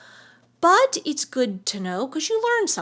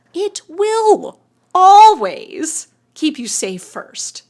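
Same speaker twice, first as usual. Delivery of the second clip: slow emphasis; 4 semitones higher, 9 dB louder, much slower than usual. Real, not acted. Stressing the subconscious' job.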